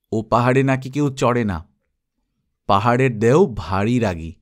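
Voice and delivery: plain reading voice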